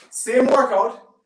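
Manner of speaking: "Same workout" said slow and loud; slow, loud emphasis on "Same workout"; emphatic stress. Real, not acted. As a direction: lean hard on "Same workout", drawn out. Stressing point to viewers.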